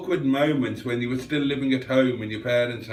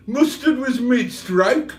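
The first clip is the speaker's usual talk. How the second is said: high, drawn-out put-on character voice; hammed-up, slow, higher voice; Cheeseburger; 7 semitones higher, 3 dB louder, slower than usual. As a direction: read it slowly in a pitched-up comic voice. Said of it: "Cheeseburger says".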